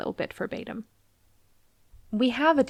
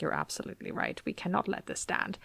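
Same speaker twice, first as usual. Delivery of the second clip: Zeus agreeing in a whisper; hushed, conspiratorial agreement; 2 semitones lower, 9 dB quieter than usual. Retold or imitated. Zeus whispers back to Helios about the cows. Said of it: "And Zeus is like".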